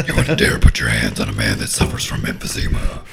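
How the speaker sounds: very low, slurred Bobcat growl; belligerent drunk. Retold or imitated. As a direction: play a belligerent drunk picking a fight. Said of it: "Bobcat doesn't talk like that anymore".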